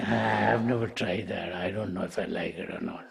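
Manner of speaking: quiet, sinking hesitant reply; doubtful reluctance. Acted. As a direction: trail down softly, unconvinced. Pig doubts the unfamiliar food.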